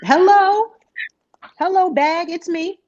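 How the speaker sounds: high, sing-song hello; playful greeting. Pretend. Greeting her paycheck, high and playful. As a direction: call out high and playful to money.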